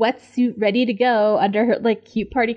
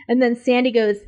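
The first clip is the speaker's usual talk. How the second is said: ordinary talk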